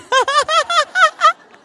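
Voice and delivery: high, loud burst of laughter. Real, not acted. Preacher's wife laughing at the congregation, loud laugh. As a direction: cackle with delight.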